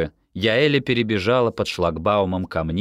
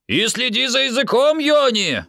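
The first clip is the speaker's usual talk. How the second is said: high, raised voice calling back; shouted rebuke; 4 dB louder than usual. A narrator's reading. Amihai scolds Yoni from the bus step, shouting. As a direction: yell it back over a distance. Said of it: "крикнул Амихай с последней ступеньки".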